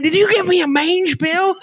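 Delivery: high, incredulous accusation; mock-panicked demand; alarmed question. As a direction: friend asking in high disbelief. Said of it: "He said".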